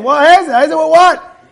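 high-pitched, baffled mock questioning; incredulous questioning. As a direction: act out a disbelieving listener. Acts out disciples' baffled questioning.

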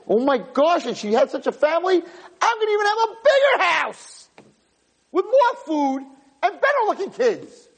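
very high, hammed-up elation; acted overjoyed girl, climbing to a squeal; energized bunny excitement. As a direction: burst out giddy and squealing with new hope. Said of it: "She's gonna be the energized bunny rabbit".

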